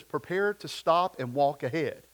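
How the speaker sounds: usual speaking voice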